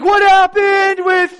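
high, slow, drawn-out shout; drugged yell. Retold or imitated. Waking patient shouting at the staff. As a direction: bellow it, dazed and confused. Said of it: "I'm yelling at the top of my lungs".

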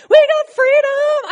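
a freed people shouting in celebration; joyful shout. Retold or imitated. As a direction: shout your freedom to the sky.

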